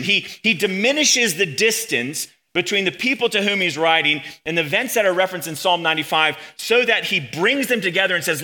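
ordinary talk

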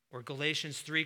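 usual speaking voice

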